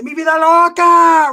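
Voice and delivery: high, slow shouted war cry; slow, high yelled gang cry; retold battle cry. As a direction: belt it like drawing a weapon. Pulling the weapon, war cry.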